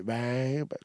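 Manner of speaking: usual speaking voice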